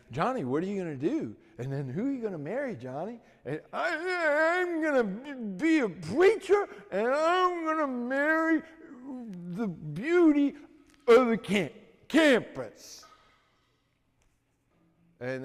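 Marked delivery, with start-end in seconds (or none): 0.1-3.2 fast taunting. Retold or imitated. Boys taunt him. 3.7-13.0 high, loud stammer catching on every sound; stuttering. Retold or imitated. A mock stutter for the preacher boy telling his dream.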